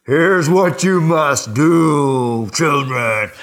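mock thundering dad, slow and loud; thundering parent. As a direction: thunder down at the children like a stern father. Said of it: "I don't thunder down".

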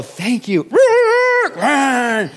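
high loud hammed-up thanks then flee; mock getaway. Preacher mocking a driver who speeds off after mercy, loud and high. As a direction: bark it like you're about to bolt. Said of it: "you don't go".

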